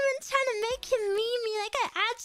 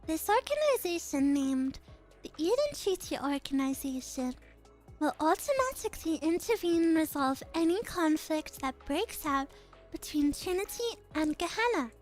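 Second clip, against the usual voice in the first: lower, measured and calm; composed noble girl; 6 semitones lower, 3 dB quieter than usual. Acted. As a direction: speak with quiet authority. Same character voice continues.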